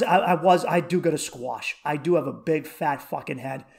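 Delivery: ordinary talk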